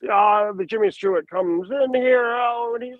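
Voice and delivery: high, slow, hesitant and lackadaisical, stumbling over words; lazy, casual rambling with a Jimmy Stewart flavour; Heisenberg voice. Shown as a demonstration. Showing the interviewer his villain's stumbling rhythm, casual and lazy. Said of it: "So Carl Heisenberg, for instance".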